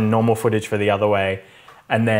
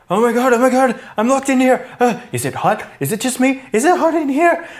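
mock panic; 14 semitones higher, faster than usual. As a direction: ham up a freaking-out cop. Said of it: "He's like".

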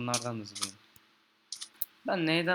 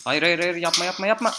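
loud, high panicked pleas; desperate shouting; 6 semitones higher, 10 dB louder than usual. Real, not acted. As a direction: beg frantically at full volume. Desperate plea as creeper explodes.

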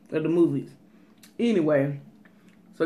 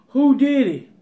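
demanding shout; 6 semitones higher, 7 dB louder than usual. Retold or imitated. Parent demands who broke TV.

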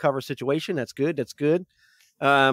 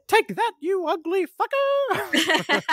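high, slow mocking yell; gleeful battle cry; much slower than usual. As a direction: crow it at the enemy. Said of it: "it's like".

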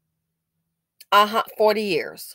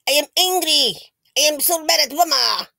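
put-on higher, louder whiny kid voice; mock angry child; 6 semitones higher, 4 dB louder than usual. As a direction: imitate a pouting kid who is mad at mama. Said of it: "'Cause he mad".

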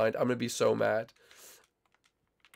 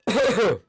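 cough; 9 semitones higher, 7 dB louder than usual. Real, not acted. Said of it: "my voice is still healing".

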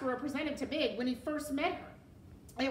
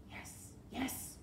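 hushed, unvoiced whisper; 2 semitones higher, 9 dB quieter than usual. A narrator's reading. Rat whispers from the girl's pocket, whispered. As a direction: whisper it from hiding. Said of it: "whispered Roscoe from her pocket".

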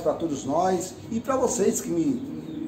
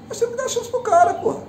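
heated high-pitched plea; 3 dB louder, faster than usual. Real, not acted. Rant, pleading for the coach.

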